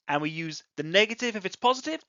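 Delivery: normal talking voice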